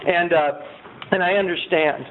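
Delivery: normal talking voice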